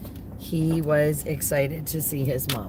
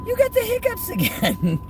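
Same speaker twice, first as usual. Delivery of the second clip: much higher, cooing to the dog; high puppy talk. Real, not acted. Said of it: "repeated higher".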